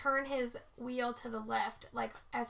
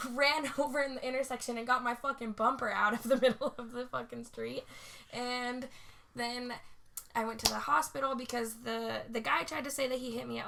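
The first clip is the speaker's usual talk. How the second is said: ordinary delivery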